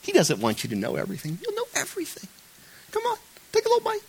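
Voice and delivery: very high, soft wheedling voice; coaxing tempter. Retold or imitated. Sleazy tempter urging Eve to bite, high and wheedling. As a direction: wheedle them into taking a bite. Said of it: "you get that impression".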